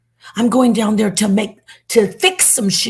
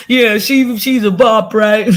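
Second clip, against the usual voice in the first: mocking troll voice; 3 semitones higher, 5 dB louder than usual. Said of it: "be like".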